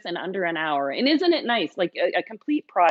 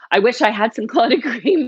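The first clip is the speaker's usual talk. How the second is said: laughing, raised and louder; laughing wistfully; 5 semitones higher, 5 dB louder than usual. Real, not acted. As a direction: wish for it with a laugh. Wishes she had clotted cream.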